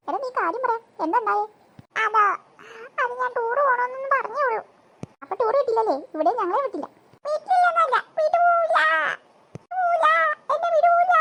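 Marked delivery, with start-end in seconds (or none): 3.0-4.6 higher-pitched tearful explanation; crying. Acted. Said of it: "നീ എന്തിനാ കരയുന്നേ". 7.2-11.1 loud, high hammed-up wail; bawling. Boy bawls that he's refused.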